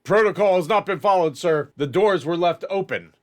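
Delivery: guard at attention reporting to the officer; anxious guard report. Acted. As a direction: report it stiffly and nervously.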